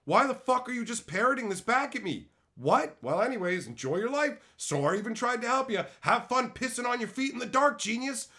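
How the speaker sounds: high, indignant telling-off; exasperated mock rant. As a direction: rant at him in sarcastic exasperation. Acted rant at the stranger.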